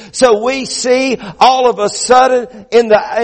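emphatic sermon, phrases pushed out one by one; preaching. Acted. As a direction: proclaim it firmly to the congregation. Preaches with rising force.

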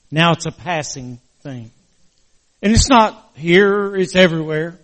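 preaching. Acted. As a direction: lift it into a preaching plea. Pastor rising into preaching.

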